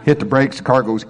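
ordinary talk, nothing marked

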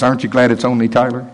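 ordinary talk

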